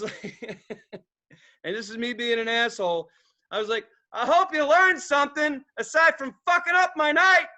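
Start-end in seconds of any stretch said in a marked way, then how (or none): 4.1-7.5 higher, louder taunt; loud mock heckle. Bystander heckling the girl who ruined his night. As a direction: needle her like a heckler.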